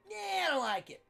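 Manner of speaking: high, whiny dismissive impression. Imitates [name] dismissing his card trick.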